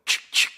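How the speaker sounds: imitated shotgun cocking; click-clack of a pump shotgun; loud mouth-made shotgun sound; shotgun pump noise. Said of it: "you hear a shotgun go".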